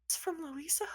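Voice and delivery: small, high, softened question; mock tearful, hushed hope. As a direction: ask it as if tearing up. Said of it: "starts crying, tears rolling down".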